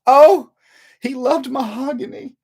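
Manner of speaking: high wailing mother's sigh; hammed-up grieving mom, drawn out slowly; a widow swooning over the coffin; mock tearful mother. Grieving mother voiced in story.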